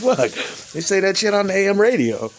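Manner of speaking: high, incredulous complaint; exasperated. Real, not acted. Vlogger ranting at YouTube censorship, exasperated. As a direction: throw up your hands at it.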